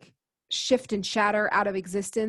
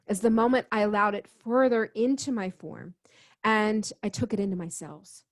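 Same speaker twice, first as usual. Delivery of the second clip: usual speaking voice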